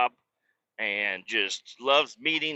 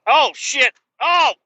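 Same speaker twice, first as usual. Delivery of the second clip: real high, loud startled outburst; startled yell; 14 semitones higher, 9 dB louder, slower than usual. Real, not acted. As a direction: yelp at a sudden jolt. Startled by bumps while driving.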